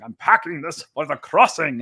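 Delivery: a light hint of Patrick Stewart's stage voice; lightly voiced British actor, half-mock. A fan imitating how the book's knight sounds in his head, a hint of stage voice. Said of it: "I hear Patrick Stewart's voice".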